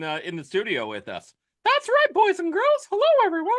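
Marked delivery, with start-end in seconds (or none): 1.6-3.5 high, loud squeaky cartoon mouse; Mickey Mouse voice. Co-host says hello to listeners in a high, loud Mickey Mouse voice. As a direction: greet kids as Mickey Mouse. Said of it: "that's Mickey Mouse here".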